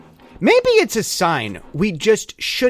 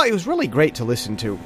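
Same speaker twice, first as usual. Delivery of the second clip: normal talking voice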